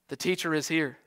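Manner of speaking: ordinary talk, nothing marked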